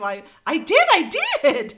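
loud, high gleeful outburst; excited exclaim. Retold or imitated. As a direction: burst out in delighted disbelief. Retelling own reaction.

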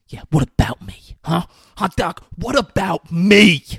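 retold fury building to a shout; fuming. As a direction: start testy, then explode loud and high. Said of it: "He's fucking fuming".

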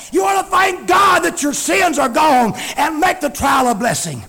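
full-force preaching. Real, not acted. Preacher urging church to thank God, full-force preaching. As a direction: preach it out forcefully, words stretched.

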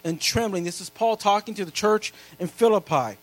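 loud, high preacher's reading of scripture; preaching scripture. Acted. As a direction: proclaim every verse to the whole church. Reads scripture at full preaching force.

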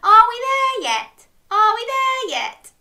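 loud, high singsong nagging; impatient child in the car. Acted. Inviting listeners to say it with her, high whiny chant. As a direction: chant it like a pestering kid. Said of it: "Can you say that with me?".

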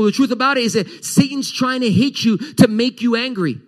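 high, intense preaching of Paul's words; fiery preaching. Acted. Pastor voicing Paul to the church, fiery and urgent. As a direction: preach it with rising passion.

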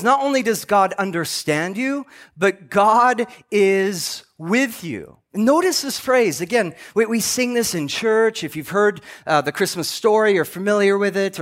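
ordinary delivery

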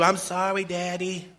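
lightly voiced small child, apologetic; a hint of a kid apologizing to daddy; child saying sorry. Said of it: "Your kid says to you".

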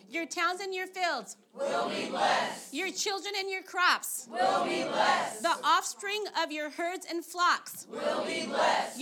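slow, loud proclaiming of scripture; preaching blessing. Acted. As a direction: declare each blessing with force.

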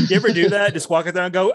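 ordinary talk

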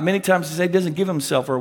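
usual speaking voice

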